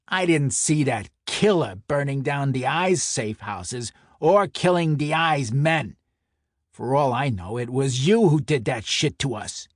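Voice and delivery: heated enforcer throwing blame; angry accusation. Acted. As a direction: lay into him forcefully. Enforcer angrily accuses the narrator.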